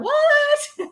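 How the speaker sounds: high squeaky "What?"; playful "What?" to a friend; mock surprise. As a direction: pretend to be amazed.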